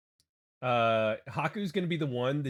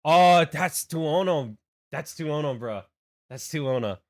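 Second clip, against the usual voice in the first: easygoing Hawaiian pidgin, a bit louder; Hawaiian pidgin accent; 5 dB louder than usual. Retold or imitated. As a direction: drop casually into a Hawaiian pidgin greeting. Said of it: "when I drop into the accent".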